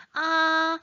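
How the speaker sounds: 아 held high on one level pitch; first tone. Shown as a demonstration. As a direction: sustain 아 high and even. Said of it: "일 성은 발음 어떻게 하지?". (아를 높고 평평하게, 일성으로)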